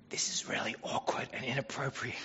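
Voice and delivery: soft unvoiced aside; gentle whispered request. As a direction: whisper it discreetly so only she hears. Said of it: "he could have whispered to her and said".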